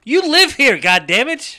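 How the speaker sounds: loud, high exasperated yell; mock outrage. Real, not acted. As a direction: shout it in mock disbelief.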